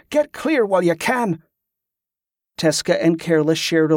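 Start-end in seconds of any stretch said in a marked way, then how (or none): 0.1-1.4 higher, urgent plea to go; insistent demand. A narrator's reading. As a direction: press them to leave. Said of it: "Wick demanded".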